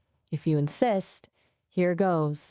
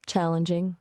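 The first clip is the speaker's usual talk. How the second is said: ordinary delivery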